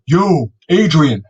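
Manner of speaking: a light hammed-up Rocky yell; a hint of Rocky calling out; mock Rocky shout. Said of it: "I don't want you going".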